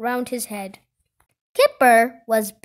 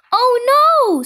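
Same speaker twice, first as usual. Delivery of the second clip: high, loud "oh no"; dismayed cry; 11 semitones higher, 8 dB louder than usual. Acted. Kipper finds he is a giant, dismayed. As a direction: cry out in alarm, pitched high.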